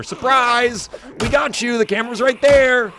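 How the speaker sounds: high, loud hammed-up prank reveal; mock prankster yell. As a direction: burst out like a YouTube prankster springing a surprise. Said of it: "this is not a YouTube prank video".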